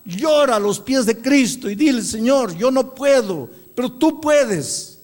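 loud, high, impassioned sermon delivery; ringing pulpit proclamation; full-force preaching. Acted. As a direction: preach it out to the whole church.

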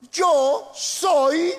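high, loud, slow testimony shout; preaching at full force. Acted. Pastor testifying to the church, loud and impassioned. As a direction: proclaim it like a living witness.

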